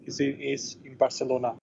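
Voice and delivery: usual speaking voice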